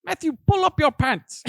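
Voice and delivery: high, loud aunt impression; imitating shouting aunt. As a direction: holler a scolding like an aunt, breaking into laughter. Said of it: "your aunt sounds like that".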